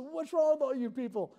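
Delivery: loud and high, scolding; exasperated shout. Retold or imitated. Lifeguard scolding the teenagers, loud and exasperated. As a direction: demand an answer from them. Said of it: "I said".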